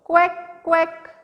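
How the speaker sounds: high, cartoonish duck quacking; playful high quack, quack. Shown as a demonstration. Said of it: "What does duck say?".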